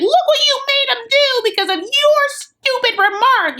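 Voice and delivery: very high-pitched mock outburst; mock angry blame. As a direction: imitate an upset parent in a squealing high voice. Acted quarrel over crying baby.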